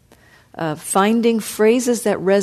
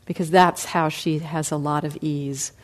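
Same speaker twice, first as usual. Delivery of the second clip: usual speaking voice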